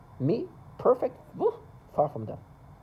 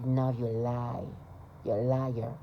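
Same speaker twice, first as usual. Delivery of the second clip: slow, lowered taunt in someone else's voice; mocking accuser voice; 4 semitones lower, slower than usual. Imitating neighbors taunting him, slow and sneering.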